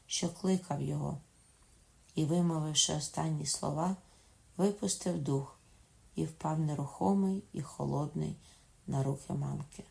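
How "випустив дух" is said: ordinary delivery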